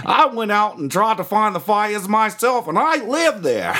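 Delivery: high, slow, indignant mock Australian; hammed-up aggrieved Aussie, stretching words; Australian accent. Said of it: "One of those dudes from Australia".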